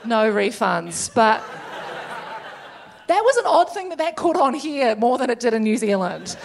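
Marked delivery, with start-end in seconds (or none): none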